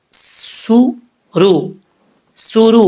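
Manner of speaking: سُرُ with a sharp hissing s, very slow; whistled seen. Shown as a demonstration. Teacher models whistling seen in سُرُ. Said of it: "سیٹی کے ساتھ پڑھیں گے".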